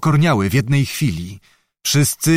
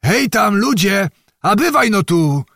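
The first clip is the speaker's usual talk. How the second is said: calling out; 8 semitones higher than usual. A narrator's reading. Old noble hailing the herdsmen from afar, calling out. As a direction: call the men over from a distance.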